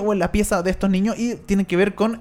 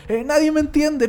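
put-on whiny teen girl; 9 semitones higher than usual. Teen girl tantrum in story.

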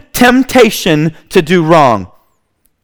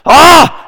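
short, high cry of alarm; acted scream; 8 semitones higher, 4 dB louder than usual. Potiphar's wife faking distress, a short acted scream. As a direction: cry out in a brief mock scream. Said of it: "she decides to scream".

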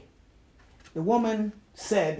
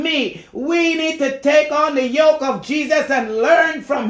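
loud, high shouted exhortation; full-force preaching; 7 semitones higher, 9 dB louder than usual. Real, not acted. Calling people of God to take the yoke, full-force preaching. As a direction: shout it to the congregation, landing each phrase.

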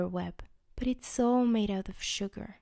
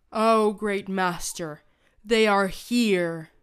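loud, slow booming announcement; giant's shout; 7 dB louder, slower than usual. Acted. Big Trevor announcing the prisoners to the great master. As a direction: call out grandly to the master. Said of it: "shouts the big Trevor".

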